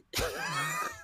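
sudden high-pitched laugh. Real, not acted. Laughing at how UK guys would react, high squeal.

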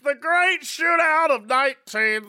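put-on slow, shouted old announcer; baseball announcer. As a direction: call it like an old radio announcer.

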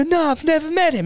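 high-pitched pitiful retold admission; mock crying. Husband admitting it to his missus, mock crying. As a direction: whimper it high, hammed up. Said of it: "She sees me crying".

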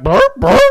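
high squeaky burp noises; mock burps.

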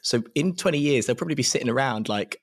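usual speaking voice

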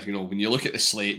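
ordinary talk

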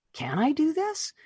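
high-pitched retold wondering; self-doubting question. Author wondering if she can channel, high-pitched doubt. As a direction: ask yourself in a high, unsure pitch.

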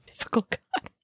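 dry whispered echo. Real, not acted. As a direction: whisper it back, deadpan. Echoing partner's joke.

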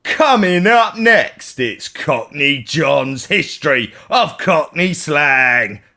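loud, stretched-out, high-pitched announcer hype; big hammed-up film trailer intro; movie trailer announcer. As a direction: belt out the programme announcement slowly. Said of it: "Did it not sound like the guy who does them films?".

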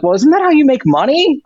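mock-innocent jab at the council; incredulous high retort; exasperated retort. Arguing with town council.

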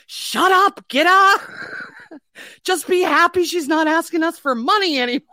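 loud, high-pitched exasperated dad; mock yell, imitating a fed-up father; big hammed-up retort from dad; dad shouting her down. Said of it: "my dad going".

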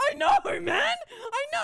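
very high, loud squeal; half-laughing mock wail, pitched right up; panicked. Retelling being grabbed, panicked protest.

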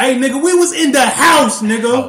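loud protesting yell at his friend; indignant shout. Real, not acted. Shouting at his co-host in protest. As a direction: shout him down, outraged. Arguing loudly back at friend.

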